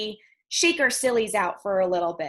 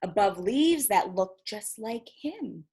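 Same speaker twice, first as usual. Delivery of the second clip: put-on lively storybook narration for kids; reading to children. A narrator's reading. Teacher finishing Leaf Man for the class. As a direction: read it with gentle wonder.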